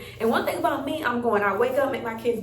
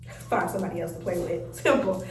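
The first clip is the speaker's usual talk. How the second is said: low, softer, curt dismissal; moody, acted brush-off; dismissive brush-off; 7 semitones lower, 4 dB quieter than usual. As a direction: send them away in a low voice. Acting moody mom dismissing her kids.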